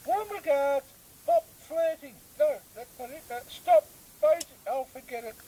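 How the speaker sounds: very high, squeaky, whiny complaining voice; a squeaky, whiny politician who keeps forgetting his own name; squeaky opposition leader. Acted. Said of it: "some fellow talking in a squeaky voice".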